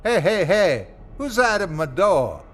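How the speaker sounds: Smitty calling out at something scratching his door. Acted. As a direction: shout it toward the door. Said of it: "Smitty called out".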